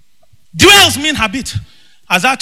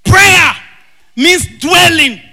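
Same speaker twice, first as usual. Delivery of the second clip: full-force preaching; 5 semitones higher, slower than usual. Acted. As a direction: hammer each word to the church.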